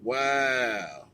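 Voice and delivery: usual speaking voice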